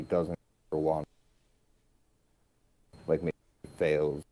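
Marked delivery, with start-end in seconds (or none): 3.1-4.2 audio cutting out; choppy call audio; bad connection. Real, not acted. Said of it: "You kinda cut out for me there".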